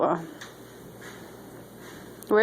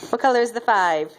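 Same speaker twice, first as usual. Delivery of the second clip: higher, quick sing-song quizzing; 3 semitones higher than usual. Real, not acted. Parent quizzing toddler on magnets.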